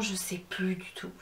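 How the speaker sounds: unvoiced whisper, dropped low; whispered aside. Real, not acted. Whispering to viewers that she has forgotten the name. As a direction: tuck it away as a quick aside.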